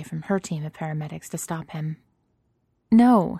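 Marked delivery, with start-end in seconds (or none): 2.9-3.4 sudden loud high no; sharp no. A narrator's reading. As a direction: cut him off with a loud no. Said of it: "she starts to say".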